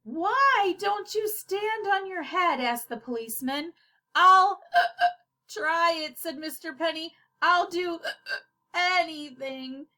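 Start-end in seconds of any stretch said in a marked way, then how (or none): 0.0-2.6 policeman. Acted. Policeman asks. 4.1-9.9 eager. Acted. Mister Penny agrees eagerly.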